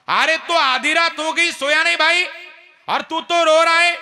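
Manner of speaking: high, loud, shouted worry; anxious calling. Acted. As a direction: demand to know why he cries. Rama questions weeping Lakhan.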